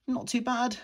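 usual speaking voice

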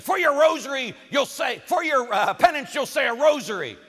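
loud, high mock scolding; imitated yelling priest. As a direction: yell the penance like an irate priest. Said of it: "this priest started to yell at me".

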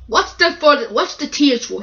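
loud, cross questioning. Acted. Controller confronts crying Gordon, cross. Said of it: "he said crossly".

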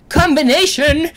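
ordinary delivery

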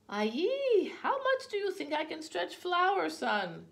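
loud, high-pitched exclamation; exasperated mother. A narrator's reading. Mama answering her son about stretching the flour, exasperated outcry. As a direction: cry out at the child in exasperation.

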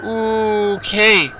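startled high okay. Real, not acted. Startled by a jump scare.

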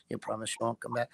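very soft, hurried mock-secret question; furtive hushed voice. Evil spirit not wanting others to hear, hushed and quick. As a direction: drop right down so nobody else can hear. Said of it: "not wanting anybody else to hear".

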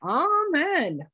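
high, drawn-out swoop falling at the end; exuberant amen. Real, not acted. Reverend capping the prayer before the lesson, exuberant. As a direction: sing out a joyful amen.

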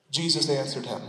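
normal talking voice

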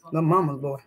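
normal talking voice

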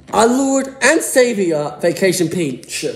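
high, loud, slow hammed-up worship; mock reverence. Kid hailing Vacation Peach with mock reverence. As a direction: proclaim her like a hammed-up saint.

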